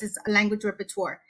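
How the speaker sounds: normal talking voice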